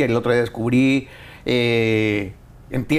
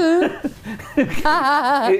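bright high burst of laughter; 11 semitones higher than usual. Real, not acted. Host laughing as the guest braces for politics, high laugh.

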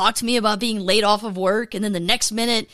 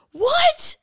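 disbelief. Real, not acted. Disbelief at himself.

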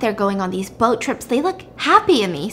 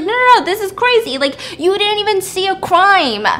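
much higher and louder, brushing off her fears; retold husband scoffing at her, pitched way up; dismissive husband; 10 semitones higher, 6 dB louder than usual. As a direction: wave it away in a put-on husband voice. Husband dismisses wife's claim.

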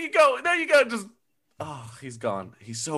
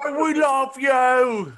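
screaming fan; 12 semitones higher, 4 dB louder, slower than usual. Acted. Fan in concert crowd.

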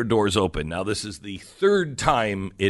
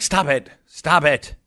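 mock scolding, pitched higher; 5 semitones higher than usual. Parents telling the kids to stop, mildly scolding. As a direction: retell a sharp telling-off.

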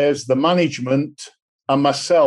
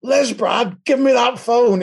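excited; 8 semitones higher than usual. Retold or imitated. Husband grabs phone excitedly.

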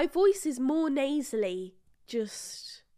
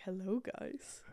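much lower, soft put-on voice; lowered mock new-voice greeting; pretend new voice; 4 dB quieter than usual. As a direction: play a stranger's deep unblocked voice. Imitating her unblocked voice.

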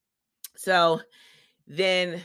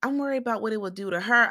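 Janelle; 7 semitones higher, faster than usual. Retold or imitated. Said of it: "Janelle's all like".

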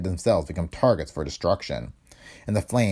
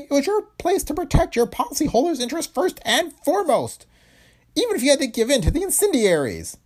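high, loud accusing shout; violent anger; 4 dB louder than usual. A narrator's reading. Owner blaming the insurance president, furious high shout. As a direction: lash out at him, pitch high throughout. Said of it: "Heron turned on the newcomer with angry violence".

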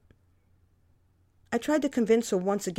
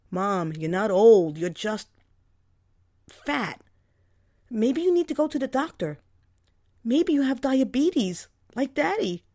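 blunt scolding; 4 semitones higher, 5 dB louder than usual. Acted. Daughter scolding, worried.

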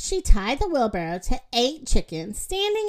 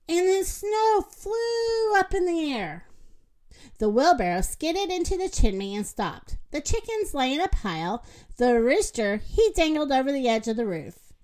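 usual speaking voice

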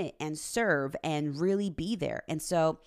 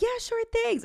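sing-song, much higher teasing yes; mock innocent; 3 dB louder than usual. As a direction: play it innocent, voice pitched way up. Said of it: "And I'm like".

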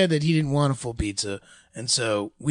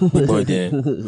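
gangster villain; 5 semitones lower, slower than usual. Acted. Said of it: "You're like a Dick Tracy villain".

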